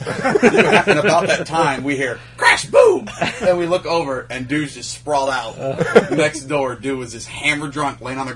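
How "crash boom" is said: loud high crash noise; crash sound effect. Retold or imitated. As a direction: shout the crash.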